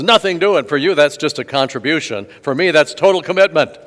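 hammed-up pig delivering the punchline; indignant pig.

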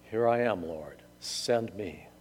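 humble offering. Acted. Isaiah answers God's call, softly and humbly. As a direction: offer yourself quietly and reverently.

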